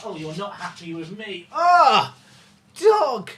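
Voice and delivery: normal talking voice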